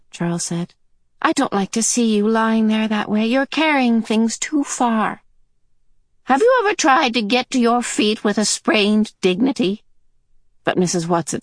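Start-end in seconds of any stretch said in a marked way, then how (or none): 1.3-5.2 lightly voiced boy, disapproving. Charles telling the old woman off, mild disapproval. 6.3-9.8 put-on high, wry old lady; old woman voice. Mrs Watson answering Charles, high old lady voice.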